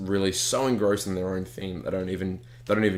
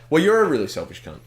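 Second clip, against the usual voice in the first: mock insult; 7 semitones higher, 5 dB louder than usual. Teasing friend mid-chat.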